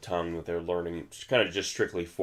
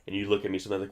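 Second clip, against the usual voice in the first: usual speaking voice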